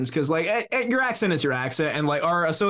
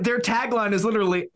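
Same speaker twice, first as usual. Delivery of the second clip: usual speaking voice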